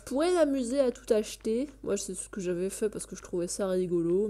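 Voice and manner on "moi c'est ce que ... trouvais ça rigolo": ordinary delivery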